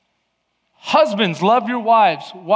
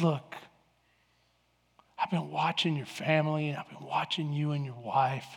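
much softer, low and earnest; earnest quiet confession; 4 semitones lower, 10 dB quieter than usual. Retold or imitated. Stranger on the beach quietly confessing to the preacher. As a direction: confide it gently.

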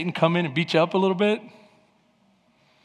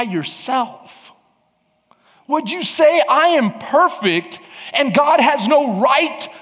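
slow, raised, indignant questioning; God challenging Job; 7 semitones higher, slower than usual. Acted. God asks Job who is just, slow indignant challenge. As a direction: draw out each accusation, climbing higher. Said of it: "This is God".

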